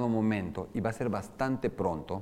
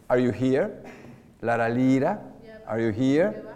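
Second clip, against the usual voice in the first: louder, rising end on "here"; question tune in English; 2 semitones higher, 4 dB louder than usual. Shown as a demonstration. Teacher tests the question rise in English. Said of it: "me voy a contradecir".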